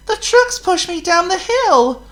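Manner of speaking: high, small pleading voice; meek little engine. A narrator's reading. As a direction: explain meekly. Thomas explains to the boss.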